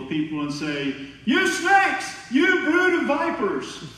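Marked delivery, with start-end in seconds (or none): 1.2-3.8 much higher and louder, hammed-up condemnation; mock condemning shout. Preacher mocking a zealot yelling at strangers' table, hammed-up shout. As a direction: bellow it like a self-righteous street preacher, stretching vipers. Zealot accusing McDonald's diners.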